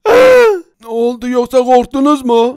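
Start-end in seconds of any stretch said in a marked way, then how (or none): 0.1-0.6 high, loud cartoon gasp; sudden high yelp of fright; startled gasp. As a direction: gasp in exaggerated shock. Startled at the threat.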